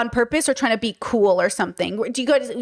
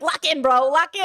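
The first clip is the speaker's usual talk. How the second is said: higher-pitched mock Gen Z slang; imitated Gen Z bro; 4 semitones higher than usual. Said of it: "that little Gen Z, like, speech".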